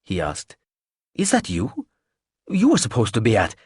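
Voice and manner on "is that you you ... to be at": surprised. Acted. Startled man recognising Claudia.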